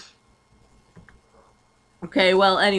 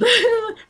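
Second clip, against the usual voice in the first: laughing; 6 dB louder than usual. Real, not acted. Cracks up at amnesia potion joke.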